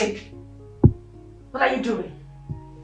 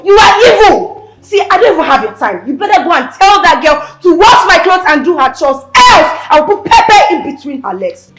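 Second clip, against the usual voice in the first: louder, higher furious insults; angry shouting back; 3 semitones higher, 6 dB louder than usual. Acted. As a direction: hurl the insults at him. Wife shouting back at husband.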